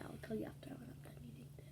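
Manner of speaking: fast whisper with a mouthful; quick hushed aside while eating. Real, not acted.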